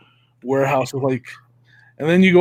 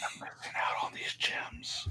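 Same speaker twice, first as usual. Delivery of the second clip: breathy mock-spooky whisper; 19 dB quieter than usual. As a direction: whisper it like a tempting voice. Whispered yard sale calling.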